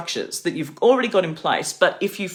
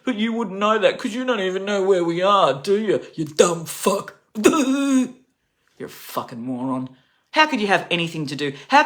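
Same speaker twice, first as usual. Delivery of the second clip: furious rant; 4 semitones higher than usual. Real, not acted. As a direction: jeer at him like he's an idiot. Ranting at Trump directly.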